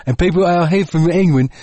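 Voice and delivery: his mixed English-Aussie accent, lightly put on; a jokey English accent, half-Kiwi. Shown as a demonstration. To his co-host, showing how he'd talk: English accent. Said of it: "I'd talk like this".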